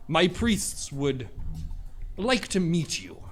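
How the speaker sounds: a regal dragon speaking through a letter; deep reptilian voice. Acted. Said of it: "a very deep, almost, like, reptilian voice".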